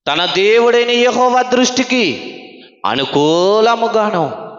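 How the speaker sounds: slow, measured Bible reading; scripture reading. Acted. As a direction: read each phrase slowly and solemnly. Reads scripture verse. (నెమ్మదిగా, గంభీరంగా వాక్యం చదవడం)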